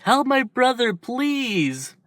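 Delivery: high, begging, fading on please; little boy sobbing for his brother; tearful plea. Acted. Izuku begging the doctor, high tearful plea.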